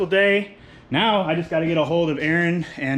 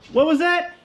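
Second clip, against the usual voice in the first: surprised; 4 dB louder than usual. Real, not acted. Sudden noise while working.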